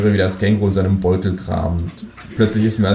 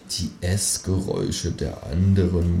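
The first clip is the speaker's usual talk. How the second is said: soft, slow musing murmur; 6 dB quieter, slower than usual. A narrator's reading. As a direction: say it dreamily under your breath. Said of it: "murmel ich".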